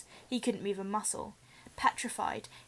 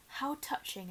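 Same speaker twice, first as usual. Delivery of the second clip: mocking hiss; 3 semitones higher, 5 dB quieter, slower than usual. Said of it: "it hissed".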